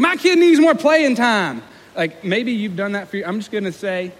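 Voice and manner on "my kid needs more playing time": high, loud, stretched-out yell; yelling sports parent. Acted. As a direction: holler it at the coach like an irate parent. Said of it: "yelling at the coach".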